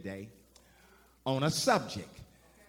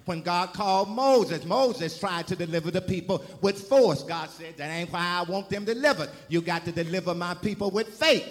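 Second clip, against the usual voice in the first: building preacher cadence, louder toward the end; rising sermon preaching; 3 semitones higher, 4 dB louder than usual. Acted. Preacher urging the congregation to get it right, building to a cry. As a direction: preach it home, climbing to a shout.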